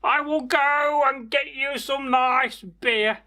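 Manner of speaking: put-on high, strained old man answering his lord, slow at the end; a put-on creaky old servant, eager to please; high old retainer's reply.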